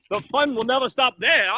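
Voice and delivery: usual speaking voice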